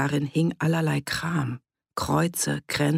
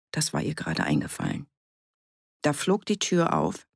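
usual speaking voice

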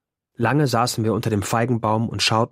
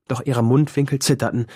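usual speaking voice

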